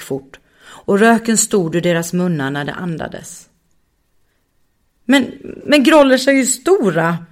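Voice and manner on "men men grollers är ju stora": close to tears. Acted. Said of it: "Gråten var nära nu".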